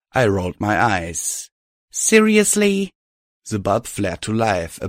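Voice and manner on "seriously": higher, louder, drawn out; exasperated. A narrator's reading. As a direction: roll your eyes at it. Hero rolls eyes.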